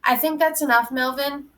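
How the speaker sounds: plain reading voice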